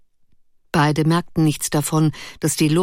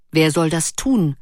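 ordinary delivery, nothing marked

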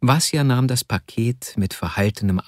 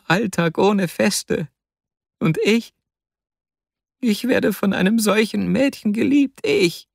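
激动地越说越高; overwhelmed outpouring; 7 semitones higher than usual. A narrator's reading. Vasja's overwhelmed tearful speech.

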